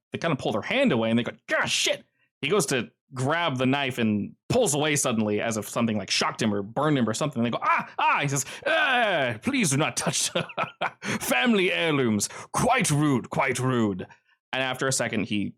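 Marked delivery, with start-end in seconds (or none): 1.5-2.0 sudden high yelp of pain. Acted. Stabbed man yelping. 7.6-8.2 sudden high yelp of pain. Acted. Stabbed man yelping. 8.7-14.1 higher, prissy villain scolding; lightly voiced smug villain, slow; Kincaid's mock politeness.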